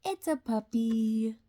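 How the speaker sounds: plain reading voice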